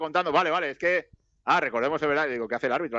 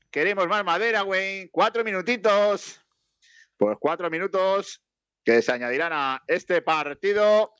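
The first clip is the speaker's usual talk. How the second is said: high, drawn-out match commentary; live play-by-play; 6 semitones higher, slower than usual. Acted. Commentator announces four added minutes, high, drawn-out play-by-play. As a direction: announce it slowly in a lifted commentator pitch.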